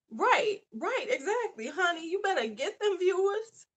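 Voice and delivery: high playful encouragement; sassy cheer. Real, not acted. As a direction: hype the viewer up. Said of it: "cheering a viewer on".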